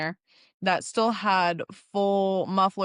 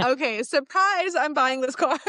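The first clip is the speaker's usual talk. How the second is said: excited reveal; 6 semitones higher than usual. Retold or imitated. Reveals surprise purchase to boyfriend.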